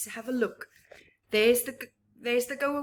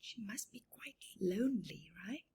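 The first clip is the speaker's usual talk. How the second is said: whispered aside; 9 dB quieter, faster than usual. Real, not acted. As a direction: lean in and whisper. Aside to listening child.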